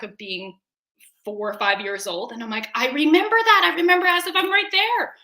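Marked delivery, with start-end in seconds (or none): 2.7-5.1 high, loud, rising burst of recollection; acted-out sudden realisation, speeding up; startled recognition. Telling shamanic girlfriend of a flashback, startled recognition.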